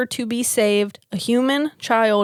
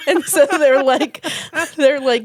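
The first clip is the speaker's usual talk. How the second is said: laughing; 4 semitones higher, 4 dB louder than usual. Real, not acted. Laughing through the setup.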